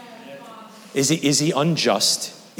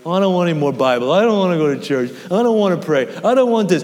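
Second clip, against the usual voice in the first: reluctant complainer; 3 semitones higher, faster than usual. Acted. Voicing people tired of God.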